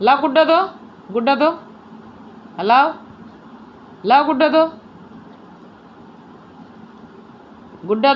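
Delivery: playful demand. Real, not acted. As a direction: tease the dog into handing over the toy. Demanding toy back from dog.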